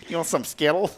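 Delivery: high, hammed-up Connecticut accent. Host tries candy name on co-host, Connecticut accent. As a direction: try a high-pitched Connecticut twang. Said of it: "say that with an accent".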